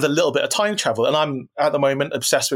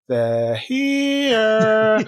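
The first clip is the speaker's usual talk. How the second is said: put-on high, drawn-out little girl's eerie line; lightly voiced spooky little girl; eerie child voice; 8 semitones higher than usual. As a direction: say it like a haunted child turning around slowly. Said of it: "she just turns around slowly and just says".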